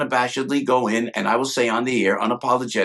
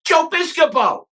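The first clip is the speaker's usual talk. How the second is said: Tom Hanks greeting, much higher and louder; a high, delighted call across the crowd; 5 dB louder than usual. Retold or imitated. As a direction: hail him like Hanks spotting an old friend. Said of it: "Tom Hanks says that".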